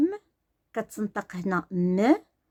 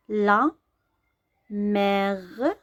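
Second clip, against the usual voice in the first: slow spelled reading; much slower than usual. Shown as a demonstration. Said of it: "ملي كتكون بوحدها كتقرا م".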